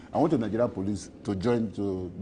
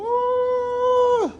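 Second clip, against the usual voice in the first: excited whoop. Real, not acted. Asked where he would live, he whoops with delight. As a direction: whoop up high, thrilled.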